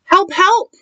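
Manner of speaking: high-pitched cry for help; mock bellow pitched high, in alarm; bellowing bear. A narrator's reading. Bear calling for help, high mock bellow. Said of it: "bellowed Bear".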